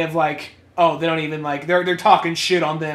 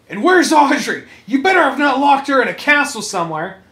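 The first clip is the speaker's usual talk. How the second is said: worried demand; 7 semitones higher, 6 dB louder than usual. Acted. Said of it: "he's like".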